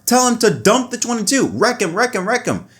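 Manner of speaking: ordinary talk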